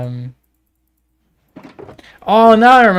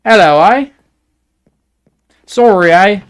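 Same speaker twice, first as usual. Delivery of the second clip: higher and louder, a hammed-up Canadian "eh?"; mock Canadian accent; 6 semitones higher, 8 dB louder than usual. Showing viewers how Canadians talk, in a mock Canadian accent. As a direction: tease the Canadian way of talking. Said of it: "That's how Canadian people talk".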